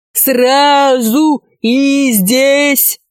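high, very drawn-out mock yell; chorus of yelling. A narrator's reading. Werewolves echoing the dwarf, a drawn-out yell. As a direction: wail it slowly like a whole gang. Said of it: "но хором завопили оборотни".

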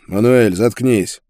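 loud, exasperated command; irritated order. A narrator's reading. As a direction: shut him up with a loud order. Prisoner silences howling cellmate.

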